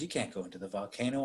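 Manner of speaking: low, hushed declaration of love; soft retold plea. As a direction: murmur a mock-romantic plea. Pleading lover, retold.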